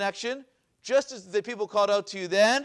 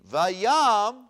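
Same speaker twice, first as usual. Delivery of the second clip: vayam drawn out and loud; stress on vayam; 8 dB louder than usual. Shown as a demonstration. Teacher showing the class the shifted emphasis, stress on vayam. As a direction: lean hard and loud on vayam. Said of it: "where is the emphasis placed".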